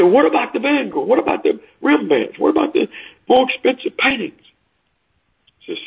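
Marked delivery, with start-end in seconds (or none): 0.0-4.4 very high-pitched, insistent questions; protesting outcry. Acted. Crowd challenging the auctioneer over the paintings, high outcry.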